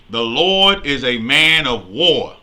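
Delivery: loud, measured Bible reading; scripture reading. Acted. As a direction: proclaim the verse to the congregation. Reads scripture aloud.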